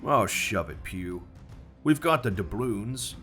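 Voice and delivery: grumbling. Acted. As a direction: grumble back at the blind man. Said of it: "grumbled one".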